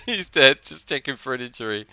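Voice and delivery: laughing through the words, high at first; amused laughter. Real, not acted. Commentator reacting to a dead player, laughing. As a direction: let the laugh spill into the words.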